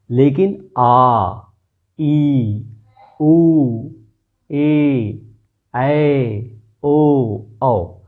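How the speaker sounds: आ ई उ ए ऐ ओ औ each held double length; long vowels. Shown as a demonstration. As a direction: sound each long vowel for two beats. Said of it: "इसमें दुगना समय लग रहा है". (दीर्घ स्वर दुगने समय तक खींचकर)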